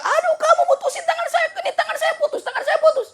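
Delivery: very high, wailing, half-laughing cry of pain; wailing king. Acted. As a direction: squeal like a king who just lost his finger. King cries over cut finger.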